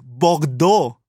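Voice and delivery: Bordeaux said the French way with a French R; French pronunciation. Shown as a demonstration. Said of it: "that actually sounds better".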